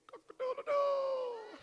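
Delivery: high, slow rooster crow; loud, long rooster call. Acted. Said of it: "the cock said".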